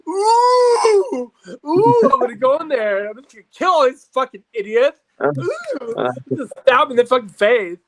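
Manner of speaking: high, loud mock rage at work; hammed-up angry cousin, high and loud; furious cousin. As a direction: shout it like a man who hates his corporate job. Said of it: "And he's like".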